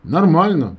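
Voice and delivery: loud, high answer; shouted reply. A narrator's reading. Narrator answering his friend by phone, shouting. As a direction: call it back down the line.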